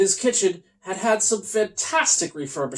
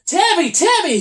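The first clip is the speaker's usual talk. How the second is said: high, louder yelled name; Toby yelling; 12 semitones higher, 6 dB louder than usual. Acted. Unicorn yelling for Tammy at the firehouse. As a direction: call her name across the station. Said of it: "he yelled".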